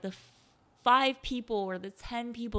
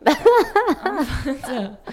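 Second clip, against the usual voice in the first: slower, louder laughing repeat; 7 semitones higher, 13 dB louder, much slower than usual. Real, not acted. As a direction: drag it out, giggling hard. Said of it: "we're a little tipsy".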